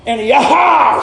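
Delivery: hammed-up attack shout; battle cry. Peter swinging the sword.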